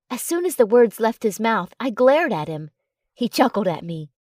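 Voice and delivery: ordinary delivery, nothing marked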